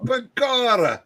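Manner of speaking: lightly voiced stage-Irish leprechaun; a hint of a jolly Irish brogue; Irish fireman voice. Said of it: "Irish firemen".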